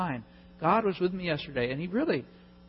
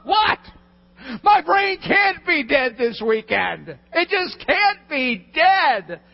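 high, loud wail of disbelief; panicked disbelief; 10 semitones higher, 10 dB louder, much slower than usual. Retold or imitated. Preacher reliving the call to Dell, panicked shouting. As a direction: drag out the protest in a full-voiced, high shout.

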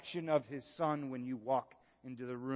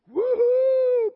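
high, loud celebratory woohoo; joyful whoop; 15 dB louder than usual. Real, not acted. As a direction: let out a big happy cheer. Celebrating forgiveness.

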